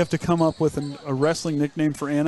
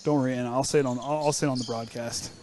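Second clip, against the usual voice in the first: quiet teasing aside; 5 semitones lower, 6 dB quieter than usual. Real, not acted. Aside to co-host.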